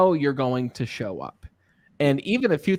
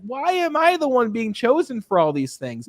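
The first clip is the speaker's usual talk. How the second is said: mock exasperated whine; 8 semitones higher than usual. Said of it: "And I was like".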